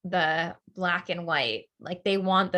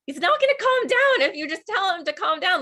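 higher, louder retold exasperated protest; exasperated retort; 12 semitones higher, 6 dB louder than usual. Daughter arguing with her mom about Connor, exasperated. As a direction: push back at mom in disbelief. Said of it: "And I'm like".

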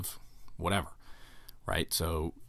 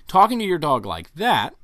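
ordinary talk, nothing marked

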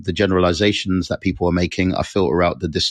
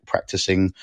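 usual speaking voice

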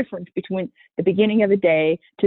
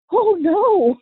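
shocked; 12 semitones higher than usual. Retold or imitated. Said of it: "And I'm like".

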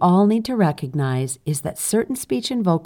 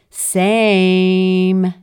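stretched, higher and louder same; long drawn-out same; 4 semitones higher, 7 dB louder than usual. Retold or imitated. Reading a listener's comment, drawn-out emphatic same. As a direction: drag out the A in Same to show emphasis. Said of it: "eight A's in there to emphasize".

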